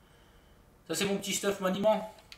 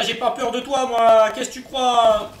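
louder, cocky challenge to the enemy; mock tough taunt; 2 semitones higher, 9 dB louder, slower than usual. Streamer taunting an in-game enemy, loud and cocky. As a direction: puff up and taunt him.

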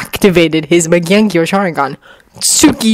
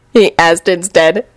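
plain reading voice

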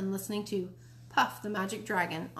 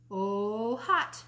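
excited cry. Acted. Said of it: "Fudge cried".

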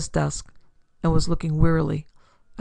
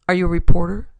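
flat; 8 dB louder, faster than usual. Acted. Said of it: "she asked Dodd flatly".

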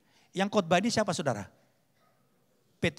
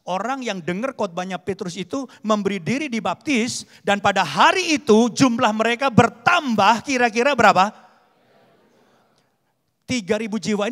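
emphatic, growing much louder toward the question; preaching build-up; 5 semitones higher, 9 dB louder than usual. Real, not acted. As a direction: build up to a loud question for the congregation. Preaches with rising force. (berkhotbah makin lantang)

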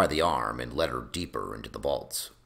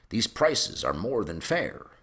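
lightly voiced lord, higher-pitched protest; contesting fat lord; 9 semitones higher than usual. A narrator's reading. Fat Lord Belmore haggling over prices, high-pitched protest. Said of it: "contested fat Lord Belmore".

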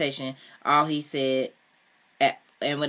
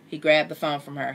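usual speaking voice

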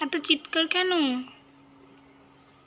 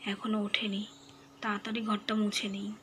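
put-on hushed, softer whisper so the child sleeps on; 5 semitones lower, 7 dB quieter than usual. Mother whispering so the sleeping child won't wake. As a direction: keep it down, she's still asleep.

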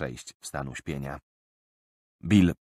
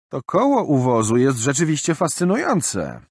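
mocking; 8 semitones higher, 6 dB louder than usual. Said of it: "zakpił Roland".

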